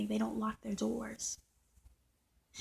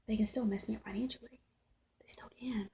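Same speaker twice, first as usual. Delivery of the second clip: whisper; 4 dB quieter than usual. Real, not acted. Confiding about money worries.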